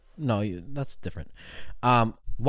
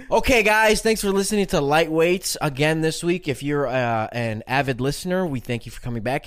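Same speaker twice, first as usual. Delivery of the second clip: podcast outro; 3 semitones higher, 4 dB louder than usual. Acted.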